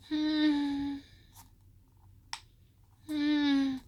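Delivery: high soft hum of a ghostly voice; imitated hum. Speaker imitating the aunt's morning hum, soft and high. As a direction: hum it like a faint voice heard at dawn. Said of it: "I could hear her".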